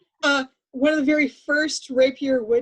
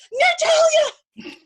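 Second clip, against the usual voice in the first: very high, drawn-out shout of Natalia; stretched, very high cry of Natalia; anguished shout; 7 dB louder, much slower than usual. Retold or imitated. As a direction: wail the name as she betrays you. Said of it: "And I'm like".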